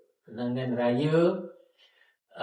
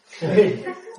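sudden high laugh; embarrassed giggle; real laugh; 8 semitones higher, 6 dB louder than usual. Real, not acted. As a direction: burst out laughing. Recalls being engaged.

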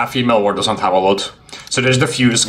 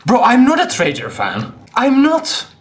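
high-pitched, rising insistence; indignant protest; 13 semitones higher, 3 dB louder than usual. Real, not acted. Streamer denying being a Traitor fan, high indignant protest. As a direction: deny it harder and higher each time.

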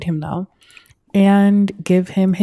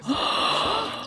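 sharp high unvoiced gasp; shocked gasp; 9 dB quieter than usual. Real, not acted. Player spotting Noelle smoking while pregnant, sharp gasp. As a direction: gasp in disbelief.

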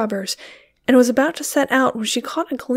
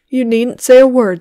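defensive; 8 dB louder than usual. A narrator's reading. Husband defends himself.

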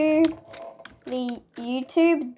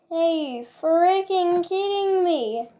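slow, higher-pitched whine; whining disbelief; 4 semitones higher than usual. Real, not acted. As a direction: whine it out in defeat. Laments losing the game.

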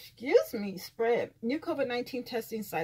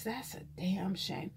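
ordinary talk

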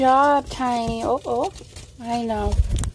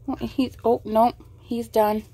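ordinary talk

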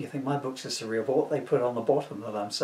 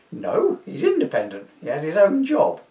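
posh voice; 7 semitones higher, 8 dB louder than usual. Acted. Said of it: "in his posh voice".

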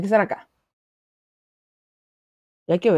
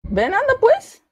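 high, louder edgy calling; nervous taunting; 10 semitones higher, 3 dB louder than usual. Real, not acted. Player baits the monster, high nervous calling. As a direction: call the monster in with nervous bravado.

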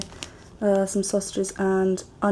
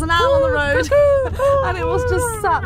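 excited; 14 semitones higher, 6 dB louder than usual. Real, not acted. Excited owl story, laughing.

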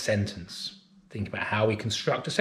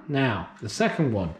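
ordinary talk, nothing marked

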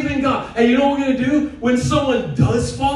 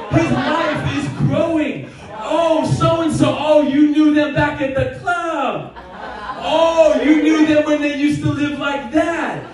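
rising, shouted, laughing preaching; excited; 6 semitones higher than usual. Real, not acted. Preacher exulting to the church, excited shout. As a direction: build to a joyful shout.

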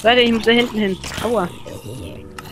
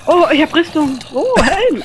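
excited; 6 semitones higher, 3 dB louder, slower than usual. Real, not acted. Excited about loot.

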